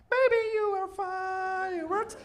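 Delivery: high, slow breathy pop diva line; sing-song it like a dreamy pop star; hammed-up celebrity swoon; mock pop star. [name] voiced on launch.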